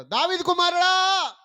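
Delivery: loud, high, slow cry for help; desperate shout. Retold or imitated. Blind man crying out to Jesus, loud desperate shout. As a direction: call out as if he might be passed by. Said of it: "గట్టిగా కేకలేస్తున్నాడంట".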